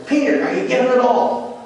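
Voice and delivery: high-pitched urgent challenge; rising voice. Retold or imitated. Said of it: "his voice rose each time he asked him".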